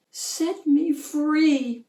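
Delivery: begging. A narrator's reading. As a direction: plead slowly for freedom. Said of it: "she begged him".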